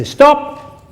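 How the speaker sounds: high, loud cut-in; sharp high yell to the kids; retold shout. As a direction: shout it suddenly to halt the crying. Shouting at crying sons.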